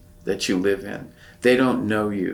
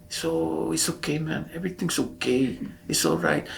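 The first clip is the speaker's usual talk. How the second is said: Louis; 7 semitones higher, 3 dB quieter than usual. Acted. Shipmate answering Spanish in English, Chicano accent. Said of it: "he would talk like this".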